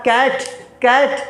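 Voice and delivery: कैट said with a hard k sound, not s; slow, clear कैट with k; hard k in cat. Shown as a demonstration. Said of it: "यहाँ सी को स नहीं पढ़ना". (कैट को क की आवाज़ से धीरे बोलना)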